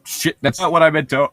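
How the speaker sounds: normal talking voice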